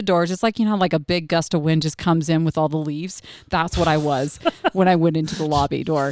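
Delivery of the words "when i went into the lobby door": usual speaking voice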